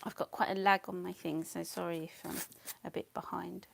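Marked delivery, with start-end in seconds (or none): none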